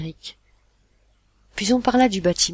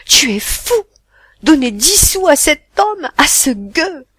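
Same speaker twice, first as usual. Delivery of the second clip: much louder, higher, slow outraged scold; 9 semitones higher, 12 dB louder, slower than usual. Acted. Mother scolding her son over the tip, outraged. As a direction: blast him for wasting money.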